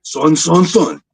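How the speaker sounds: rapid repeats rising with excitement; Comics Explained. Acted. Imitating a YouTuber who starts getting hyped, excited. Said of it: "especially when he start getting hyped".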